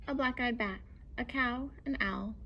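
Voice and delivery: plain reading voice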